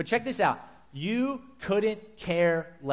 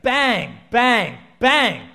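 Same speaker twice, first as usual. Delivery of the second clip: loud, rising bang, bang, bang; loud banging noise; 7 semitones higher, 12 dB louder, much slower than usual. Retold or imitated. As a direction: pound it out like a hammer on the roof. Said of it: "It's like this bang".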